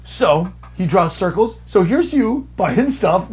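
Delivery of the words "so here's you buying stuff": higher, patronising explainer; obnoxious sales pitch. Retold or imitated. Salesman drawing circles for him, obnoxious pitch. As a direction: lay it out obnoxiously, as if to a simpleton. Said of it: "just so fucking obnoxiously to me".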